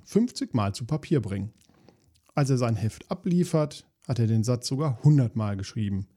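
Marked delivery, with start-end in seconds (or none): none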